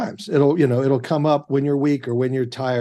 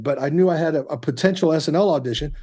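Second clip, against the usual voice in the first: normal talking voice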